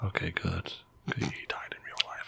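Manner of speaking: hushed unvoiced whisper. Real, not acted. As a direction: whisper it like a secret. Aside about dead actor.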